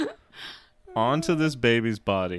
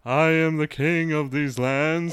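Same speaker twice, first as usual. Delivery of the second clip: Simba voice; 4 semitones higher, 5 dB louder than usual. Retold or imitated. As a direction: proclaim it like a lion king. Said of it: "full Simba and says".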